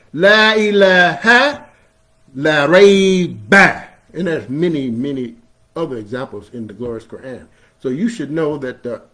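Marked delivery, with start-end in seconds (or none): 0.1-3.9 La ilaha, la rayba very slow and loud; slow emphatic proclamation. Shown as a demonstration. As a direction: lean hard on each la. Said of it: "it's emphatic".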